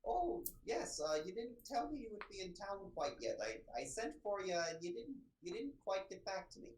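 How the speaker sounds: high, prim, scolding librarian voice, softening for Fergus; a fussy older scholar catching intruders; prim older librarian Diana. Acted.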